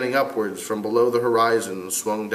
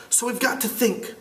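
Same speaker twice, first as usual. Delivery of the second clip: put-on boy voice; 12 semitones higher, 3 dB quieter than usual. Character voiced.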